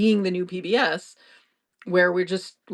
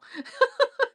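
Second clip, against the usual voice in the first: high burst of laughter; high giggle. Real, not acted. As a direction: giggle at the idea. Laughs at own fantasy.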